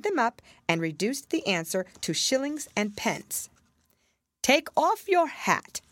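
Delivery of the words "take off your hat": high, slow royal order; a king commanding the Hatter. A narrator's reading. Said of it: "the king said to the Hatter".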